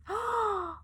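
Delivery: a sharp high gasp of delight. Retold or imitated. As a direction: gasp as if the kitten chose you. Kitten meows, delighted gasp.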